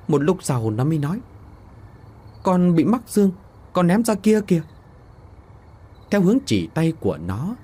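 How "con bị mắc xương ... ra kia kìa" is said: giọng trẻ con. Acted. Đứa trẻ kể lại cho bố.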